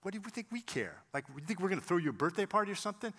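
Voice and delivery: nasty tone. Retold or imitated. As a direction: imitate a nasty, dismissive retort. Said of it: "kind of in a nasty tone".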